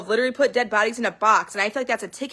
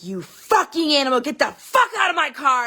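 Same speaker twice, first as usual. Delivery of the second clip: retold furious yell, higher and louder; acted livid shout, pitch raised; 4 semitones higher, 3 dB louder than usual. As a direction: reenact the rage at a friend who peed in the car. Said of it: "And I was livid".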